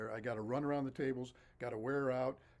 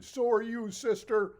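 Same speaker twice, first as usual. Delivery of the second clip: cheeky holler, much higher and louder; hollered back; 9 semitones higher, 9 dB louder, slower than usual. Retold or imitated. A boy hollering back at the nun as he escapes, high cheeky shout. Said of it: "And I hollered back".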